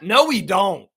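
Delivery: firm loud "No, we don't"; emphatic no. Real, not acted. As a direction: slam the door on the idea. Answers himself emphatically.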